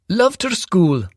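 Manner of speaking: smooth charming stepdad covering something; Ted's round tenor. A narrator's reading. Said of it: "a round tenor with a Welsh accent".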